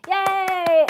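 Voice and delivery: sudden high, loud cheer; a quick burst of delight. Real, not acted. Teacher cheers for the class, high and loud.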